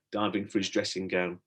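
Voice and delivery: plain reading voice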